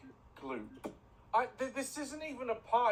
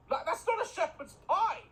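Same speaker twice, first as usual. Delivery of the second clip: higher, indignant cry; mock outrage; 7 semitones higher than usual. Real, not acted. Host mocking the cook's pie with outrage, half-joking. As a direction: protest at the cook, half-joking.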